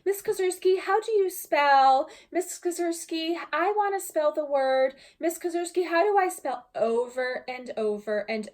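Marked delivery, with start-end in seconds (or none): 0.1-6.7 put-on high, whiny kid voices calling teacher; little kids begging for spelling help; children tugging at teacher for help, high whiny; pleading second graders. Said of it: "I would hear the words".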